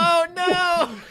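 bursts out laughing, high; laughing through a surprised cry. Real, not acted. A reveal catches them off guard.

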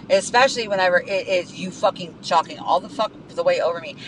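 ordinary talk

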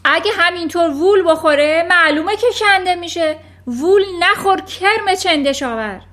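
angry yell. Acted. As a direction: snarl down at the wriggling boy. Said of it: "داد زد". (داد زدن تحقیرآمیز و خشمگین)

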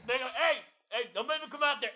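high, loud threatening coach; mock coach yell. Imitating the coach threatening a student driver. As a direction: holler a warning at the student driver. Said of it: "he would scream into the bullhorn".

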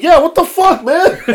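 high, loud indignant imitation; hammed-up aggrieved yell; mock outrage. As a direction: play the guy protesting being picked. Acts out singled-out passenger's protest.

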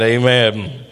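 usual speaking voice

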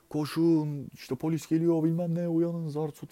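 high, loud imitated warning cry; urgent alarm call. Runner waking park sleepers as police come, retold urgent shout. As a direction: call out to rouse everyone, pitched well up.

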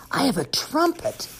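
high secret whisper. Acted. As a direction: whisper it like a surprise.

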